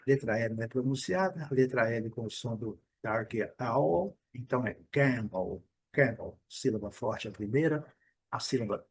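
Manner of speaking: normal talking voice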